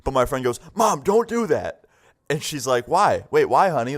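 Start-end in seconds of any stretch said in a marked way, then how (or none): 0.8-1.8 high, drawn-out whine of alarm; imitated panicking son; panicked plea. Son stops mom taking the drug. 2.9-4.0 higher-pitched mock-innocent mom; imitated mom playing dumb; feigned innocence. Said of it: "she's obviously faking it".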